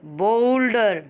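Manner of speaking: "Boulder" with a long, stretched first syllable; slow teacher's model of "boulder"; syllable stress demo. Shown as a demonstration. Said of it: "दबाव दे के बोलते हैं".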